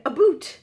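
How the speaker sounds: mock Canadian "a boot", about said as a-BOOT. Shown as a demonstration. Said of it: "stereotypical Canadian accent".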